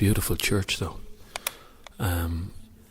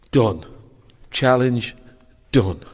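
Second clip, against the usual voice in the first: triumphant; 5 semitones higher, 7 dB louder, much slower than usual. Real, not acted. Triumph after finishing challenge.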